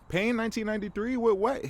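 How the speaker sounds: high-pitched, baffled questioning; exasperated, rising disbelief; incredulous. Real, not acted. Mock disbelief at the song title.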